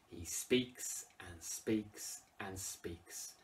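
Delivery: a slow, quiet drawn-out repetition. Shown as a demonstration. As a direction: let "speaks and speaks and speaks" drag on slowly. Demonstrates endless talk.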